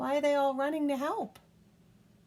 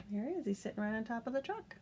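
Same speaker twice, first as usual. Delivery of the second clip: ordinary delivery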